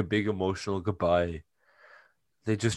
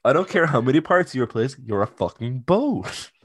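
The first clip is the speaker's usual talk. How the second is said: mock exasperated; 4 semitones higher, 5 dB louder than usual. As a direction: spell it out, fed up. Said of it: "said in retelling".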